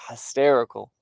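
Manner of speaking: ordinary delivery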